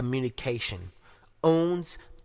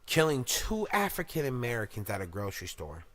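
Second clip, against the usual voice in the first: normal talking voice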